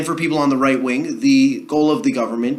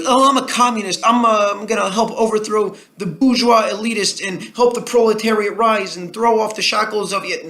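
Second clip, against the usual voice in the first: high-pitched mocking wannabe revolutionary; mock edgy communist; 8 semitones higher than usual. College kid larping as a revolutionary to seem cool, mocking impression. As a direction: parody a self-important college radical, pitched well up. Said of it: "as a way to be edgy and cool".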